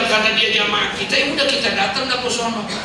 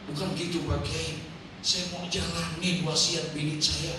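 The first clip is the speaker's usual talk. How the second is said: much softer, sheepish answer; meek reply; 3 semitones lower, 9 dB quieter than usual. Retold or imitated. Widower answers the scolding kiai, soft and meek. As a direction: answer humbly, voice dropped.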